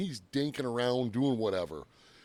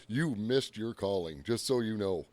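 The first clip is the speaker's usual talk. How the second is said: slow dry quote; 4 semitones lower, 3 dB quieter, slower than usual. Retold or imitated. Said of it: "he looks at me and says".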